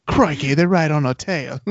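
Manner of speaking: Aussie pilot in a chase, jokey; Crikey like a panicked Outback pilot; mock Australian accent. Cohost imitating Zeon pilots in the episode, mock Australian accent. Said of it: "the Zeons take on, like, the accent".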